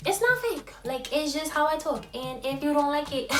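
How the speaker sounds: ordinary talk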